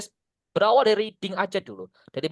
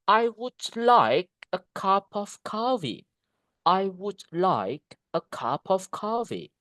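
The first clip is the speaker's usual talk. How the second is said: slow, with long stressed "like", "cup", "coffee"; rhythmic connected speech; 2 semitones higher, slower than usual. Shown as a demonstration. Teacher modelling the better way, slow rhythmic stress. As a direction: stretch "like", "cup" and "coffee", glide over the rest. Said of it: "That would be better than we say just".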